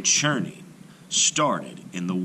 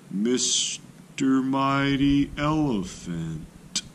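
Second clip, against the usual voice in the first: calling tortoise; 6 semitones higher, much slower than usual. A narrator's reading. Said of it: "Clever Tortoise called".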